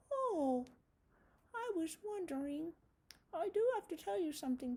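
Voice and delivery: high, curious little spider; puppet spider voice. Acted. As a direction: squeak it as a friendly puppet spider.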